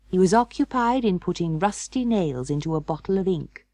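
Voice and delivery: plain reading voice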